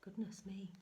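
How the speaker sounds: plain reading voice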